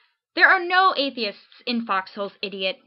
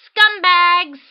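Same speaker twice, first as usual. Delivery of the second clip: loud high yell of one word; all caps yell; 8 semitones higher, 10 dB louder than usual. Shown as a demonstration. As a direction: spit it out like a capital-letter insult. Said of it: "all caps".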